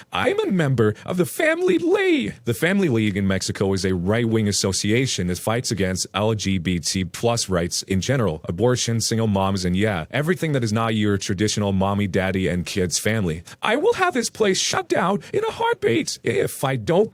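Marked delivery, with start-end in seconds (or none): 0.1-2.3 hammed-up high-pitched furious mother; screaming Karen. Narrator voices Karen. 13.6-17.1 very high, slow falsetto Karen scream; hammed-up high-pitched furious mother. Narrator voices Karen.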